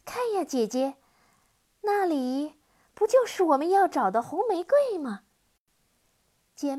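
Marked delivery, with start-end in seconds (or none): 0.1-5.2 put-on loud, high, excited child's call; happy shout. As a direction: call out to your sister in delight. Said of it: "高兴地喊".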